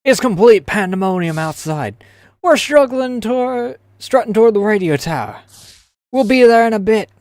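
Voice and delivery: high orc voice. Acted. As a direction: push it out like a panicked orc. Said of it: "I gotta get in the orc mindset".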